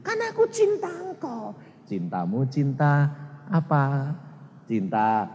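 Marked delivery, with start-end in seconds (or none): 0.1-1.6 mocking a believer's whiny excuse to God. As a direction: whine it back.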